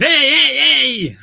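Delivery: high, loud Mancunian holler; Manc phrase. Retold or imitated. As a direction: call it out like a rowdy Manc. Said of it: "Manc phrases. Just going around going".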